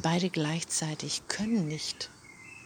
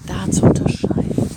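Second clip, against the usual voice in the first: suddenly much louder, driving the point home; emphatic burst; 13 dB louder than usual. Real, not acted. Coach urging viewers, loud and emphatic. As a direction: push this phrase out hard.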